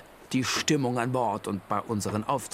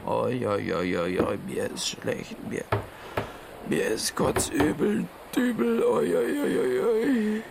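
seasick moaning. Acted. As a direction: moan like you're about to throw up.

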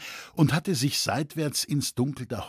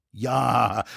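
plain reading voice

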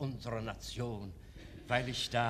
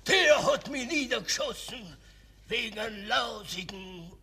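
last-strength outcry; 5 semitones higher than usual. Acted. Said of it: "deutet mit der letzten Kraft".

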